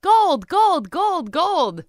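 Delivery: high, ringing gold cry; excited headline shout. Acted. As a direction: call out gold like a newsboy. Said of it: "the headline of a Seattle newspaper read".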